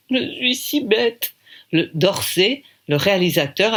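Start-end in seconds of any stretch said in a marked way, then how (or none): 0.1-1.3 high, slow, tearful little voice; a hint of crying; tearful girl. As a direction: sob out the self-reproach. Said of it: "La gamine pleure de plus belle".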